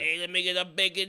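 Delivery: loud, high New York deli order; New York accent. Pretend. Co-host ordering breakfast as a New Yorker, shouted accent. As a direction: bellow it across a New York deli counter. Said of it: "how we all talk all the time".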